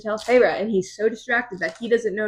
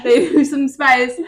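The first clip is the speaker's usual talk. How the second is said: slow, louder, pointed mock echo; mocking repeat; 4 semitones higher, 5 dB louder than usual. Real, not acted. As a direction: savour the phrase with irony.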